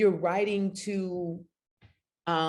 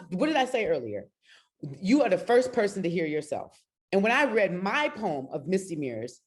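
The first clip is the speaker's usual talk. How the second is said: usual speaking voice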